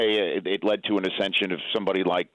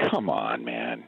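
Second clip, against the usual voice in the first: low, disbelieving groan of come on; a low fed-up come on man; exasperated; 9 semitones lower, 3 dB quieter, much slower than usual. Real, not acted. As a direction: drag it out, fed up with the question. Exasperated at poll.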